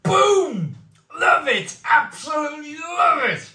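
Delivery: yelling in delight, pitch soaring; ecstatic shout. Real, not acted. Thrilled by the tasting.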